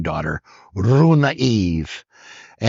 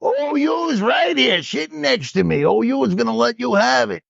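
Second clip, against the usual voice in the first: Don Russo teasing. Retold or imitated. Coach answering the umpire's jab, put-on teasing voice. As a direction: drag it out like a coach ribbing the umpire. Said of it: "And Russo goes".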